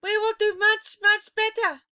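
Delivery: witches answering. A narrator's reading. Witches assuring their leader, high pitched. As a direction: promise it eagerly as a cowed witch.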